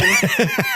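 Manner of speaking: squealing, high-pitched laugh. Real, not acted. Retelling the husband's joke to the interviewer, laughing high.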